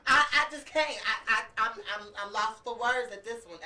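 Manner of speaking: real stammer, lost for words; stuttering. Real, not acted. Co-host reacting to shocking dog footage, stuttering. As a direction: stumble and restart on the first words. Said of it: "Look, I'm stuttering".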